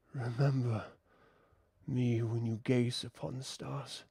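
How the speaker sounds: hushed breathy voice from the swamp; deep breathy whisper. Acted. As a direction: breathe each word softly, like the swamp itself speaks. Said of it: "deep, breathy voice".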